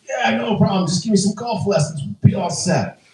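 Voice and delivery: high, breezy badly faked president; hammed-up celebrity agreeing to a deal; terrible Trump impression. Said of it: "That's a terrible impression".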